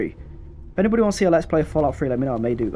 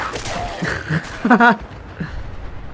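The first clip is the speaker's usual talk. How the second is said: a breathy unvoiced laugh; 8 semitones higher than usual. Real, not acted. Gamer laughing at the fight, breathy laugh.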